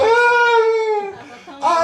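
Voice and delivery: long, high imitated wail; mock crying wail. Young man coming back from rapture, wailing in imitation. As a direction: imitate someone bursting into tears. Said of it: "Começou a chorar".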